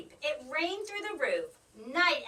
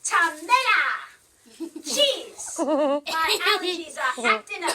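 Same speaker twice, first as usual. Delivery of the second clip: laughing spell; 4 semitones higher, 9 dB louder than usual. Acted.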